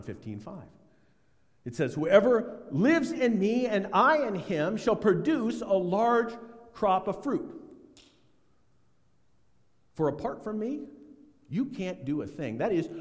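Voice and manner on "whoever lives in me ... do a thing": scripture reading. Acted. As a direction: read the verse with weight.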